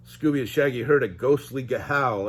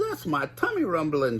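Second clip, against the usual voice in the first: higher goofy Shaggy voice; Shaggy moaning; 6 semitones higher than usual. Acted. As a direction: moan about your empty tummy. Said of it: "Shaggy moaned".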